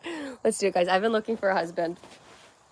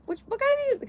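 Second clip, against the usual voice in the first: much higher, bright quoting voice; mock-innocent high question; high quoted question; much faster than usual. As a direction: squeak out the question. Quoting her own question.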